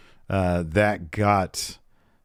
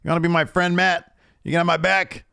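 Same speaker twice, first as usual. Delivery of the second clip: angry Page; 8 semitones higher than usual. Retold or imitated. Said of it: "And he's like".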